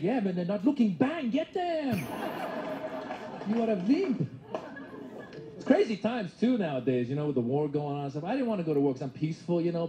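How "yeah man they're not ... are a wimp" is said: mom. Retold or imitated. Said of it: "She's like".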